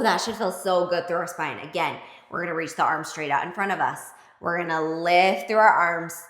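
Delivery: normal talking voice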